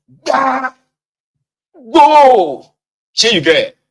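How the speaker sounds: high, loud barked command; shouting at a child during a beating; furious yell. Retold or imitated. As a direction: roar at the child to go.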